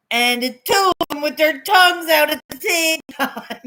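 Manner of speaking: high, loud delighted near-shout; excited gush. Real, not acted. Aunt gushing to friend about babies' tongues out, loud and high. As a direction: burst out over the cute picture.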